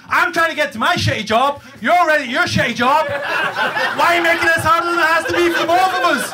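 high, loud rant climbing to a yell; losing it completely. Acted. Rider ranting at the obstructive subway clerk, exploding in exasperation. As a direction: build to a strained, high-pitched shout. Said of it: "I lost my shit, guys".

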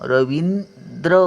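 रवींद्र said slowly, each syllable clear, the final द्र crisp; teacher stretching रवींद्र so pupils hear the र; slow careful naming. Shown as a demonstration. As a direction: sound out रवींद्र slowly for the class. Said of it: "द्र बघा कसा उच्चार येतो".

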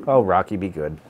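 usual speaking voice

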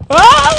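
sudden panicked scream; loud startled yelp of panic; being chased. Real, not acted.

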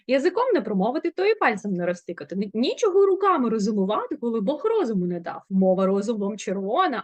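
quick crisp tongue-twister recital; tongue twister demo. Shown as a demonstration. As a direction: rattle it off clearly for the class. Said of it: "давайте покажу я".

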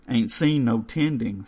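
ordinary delivery

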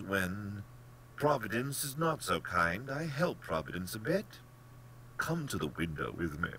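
hint at it as the genial general; a touch of playful menace; lightly voiced sly general.

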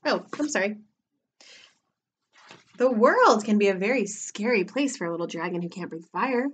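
ordinary delivery

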